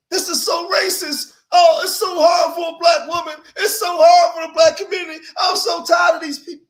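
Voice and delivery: high, loud, whiny mock wail, hammed-up; mock crying complaint. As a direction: wail like someone crying online, overdone. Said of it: "you're online saying".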